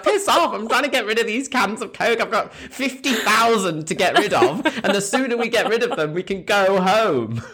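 high-pitched ranting through laughter; hammed-up fed-up promoter; mock exasperation. Acted fed-up promoter dismissing punters.

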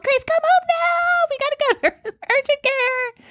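hammed-up panic, calling for help; panicked calling. Mom phoning Chris to come home, panicked calling. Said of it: "a scary situation".

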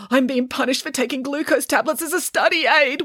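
high, tearful wail; hammed-up high-pitched weepy voice; weeping. A narrator's reading. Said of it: "wept Pauline".

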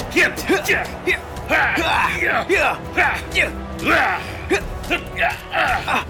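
sharp fighting grunts with each punch; fist fighting. Acted. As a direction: grunt out every blow. (teriakan saat berkelahi)

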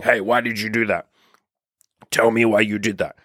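demanding, accusatory demand; accusing. Shown as a demonstration. Demanding answers from a flaking girl, accusing. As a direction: challenge her as if she's guilty. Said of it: "rather than accusing her and saying".

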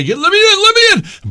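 high, loud panicked yell; retold yelling. Yelling at the guy at the gate, a high loud panicked yell. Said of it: "start yelling at the guy".

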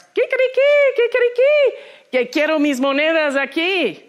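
high, loud defiant crowing; rooster crowing. Acted. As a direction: crow it out like a furious little rooster. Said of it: "all upset again".

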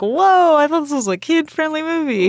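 loud, high mock astonishment; shocked reaction. Retelling her shocked reaction to film.

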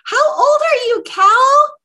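high, loud birthday teasing. Real, not acted. Co-host teasing Cal about turning fifty-five, loud and high. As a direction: shout the question with glee.

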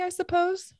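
sharply lifted wry question. A narrator's reading. Narrator asking the pony about a prophecy, wry lift. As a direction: ask it with sly doubt.

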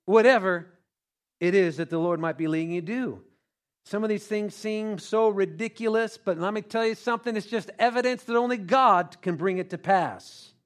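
ordinary talk

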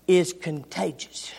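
hushed, whispered emphasis. Real, not acted. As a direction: lean in and whisper it. Whispered sermon point.

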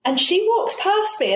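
ordinary talk, nothing marked

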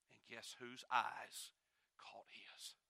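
slow, hushed breathless whisper. Real, not acted. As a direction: draw them in with a whisper. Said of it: "hushed climax".

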